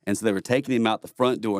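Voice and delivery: ordinary talk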